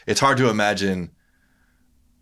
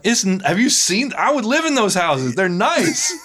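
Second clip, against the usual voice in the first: high, louder, slowed emphatic disbelief; incredulous enthusing; 14 semitones higher, 5 dB louder, slower than usual. Retold or imitated. Retelling reply to coworker, high incredulous gushing. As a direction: gush about the houses. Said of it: "I'm like".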